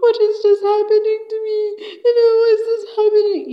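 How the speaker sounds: high, loud, fast mock wail; crying. Harriet left on the floor, high acted crying. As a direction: wail it in a hammed-up tearful voice. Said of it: "on the floor crying".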